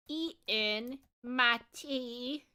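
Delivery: very slow, careful British accent; practiced British accent. Shown as a demonstration. Streamer practicing the accent tip, slow careful British accent. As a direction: sound out a British accent word by word. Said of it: "Lower the tone".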